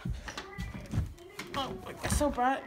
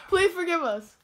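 ordinary talk, nothing marked

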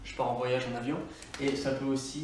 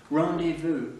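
Rendez-vous with an English R and a louder English lilt; English accent; 2 semitones higher, 5 dB louder, slower than usual. Shown as a demonstration. Teacher demonstrating English-style borrowed words to French learners, English accent. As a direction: say Rendez-vous like an anglophone, RON-day-voo. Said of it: "il faut les dire avec un accent English".

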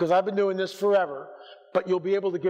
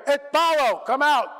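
loud, higher command in Greek; commanding Greek rebuke; 6 semitones higher, 4 dB louder than usual. Shown as a demonstration. Preacher showing the Greek casting-out command, loudly. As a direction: bark the Greek words out. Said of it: "in the Greek".

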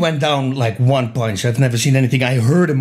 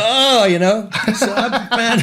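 laughing; 9 semitones higher than usual. Real, not acted. Laughing at his own panic.

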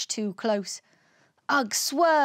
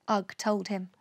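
ordinary delivery

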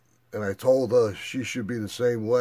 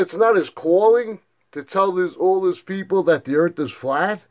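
1 incredulous retort; 7 semitones higher, 5 dB louder than usual. Retold or imitated.

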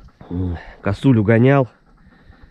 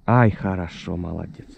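normal talking voice

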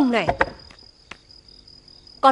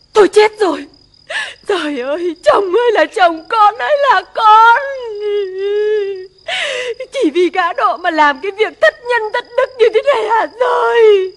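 wailing lament; 3 semitones higher than usual. Acted. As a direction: wail to the heavens in despair. Wife learns husband gambled, wailing. (khóc than gào lên)

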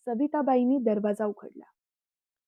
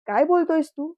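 higher and louder scolding; mother scolding her son, higher and louder; angry rebuke; 6 semitones higher, 6 dB louder than usual. A narrator's reading. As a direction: cut her son off sharply. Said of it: "रागात बोलल्या".

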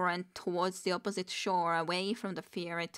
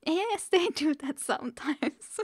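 high, loud and tickled; amused repeat; 9 semitones higher, 5 dB louder than usual. Real, not acted. Amused louder repeat of the line.

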